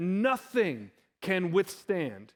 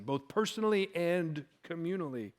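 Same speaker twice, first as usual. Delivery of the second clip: usual speaking voice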